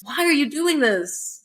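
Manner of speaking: slow, pleading imitation; lightly voiced despair; desperate. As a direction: drag out the plea.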